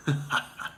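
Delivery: breathy evil cackle; whispered evil laugh; evil chuckle. Pretend. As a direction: laugh softly at your victory. Villain gloating.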